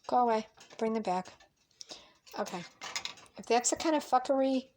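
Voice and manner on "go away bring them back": normal talking voice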